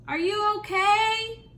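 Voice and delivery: concerned dad calling up. Acted. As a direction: call up to the boy on the ladder. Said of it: "called his dad".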